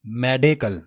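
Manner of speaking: मेडिकल as "meh-de-kal", mɛɖekəl; first syllable मेडे, not मेडी; correct pronunciation. Shown as a demonstration. Teacher models correct मेडिकल for students. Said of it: "मेडे पढ़ना है".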